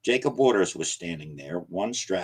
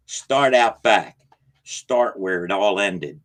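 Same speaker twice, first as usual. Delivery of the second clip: loud and slow, deliberate; old man's urgent parting advice; urgent warning; 7 dB louder, slower than usual. Acted. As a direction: spell it out from the door. Parting warning over the shoulder.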